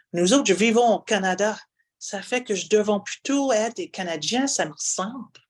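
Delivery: broad Acadian French reading; exaggerated Acadian accent. Shown as a demonstration. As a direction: lean hard into the Acadian accent. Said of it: "I'm gonna exaggerate a little bit today".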